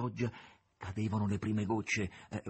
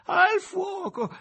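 high, long-drawn wail for help; loud, high distressed calling; pitiful cry; 10 dB louder, much slower than usual. A narrator's reading. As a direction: wail it piteously, stretching each call. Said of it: "debole, pietoso grido della cuoca".

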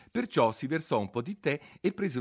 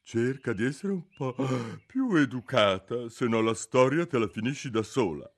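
sulky; 3 semitones higher, 4 dB louder than usual. A narrator's reading. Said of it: "il ghiro immusonito osservò".

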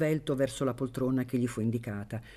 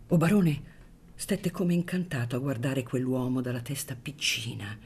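plain reading voice

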